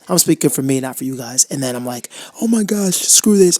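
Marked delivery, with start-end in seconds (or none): 2.4-3.6 high, loud exasperated snap; frustrated outburst. Pretend. Imagined self throwing everything out the window, exasperated outburst. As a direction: blow up and quit.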